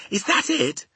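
put-on high, startled little girl's question; shocked question. A narrator's reading. Sophie asks about the thrashing dream, high startled question. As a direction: ask it high, in disbelief. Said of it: "she cried".

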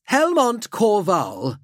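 high, slow, stately noble; helmeted nobleman. Acted. Nobleman introducing himself to Drake through a helmet, high and stately. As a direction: announce your name as a high-pitched aristocrat. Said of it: "his voice reverberating through the mouth grill".

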